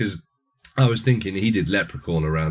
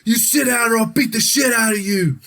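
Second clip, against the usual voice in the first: high, loud barked orders; angry warden; 4 dB louder than usual. Retold or imitated. Warden shouting at the kid inmate to shut up. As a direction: yell at the inmate to sit down. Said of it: "prison warden is like".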